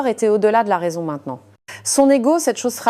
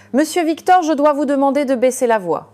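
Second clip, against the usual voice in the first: higher, louder, firm warning; determined; 7 semitones higher, 3 dB louder than usual. A narrator's reading. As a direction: insist politely but firmly. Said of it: "cette fois plus déterminé".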